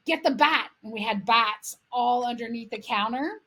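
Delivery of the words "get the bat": raised, louder retold command; urgent call. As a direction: call out dad's order for the bat. Dad calling urgently for bat.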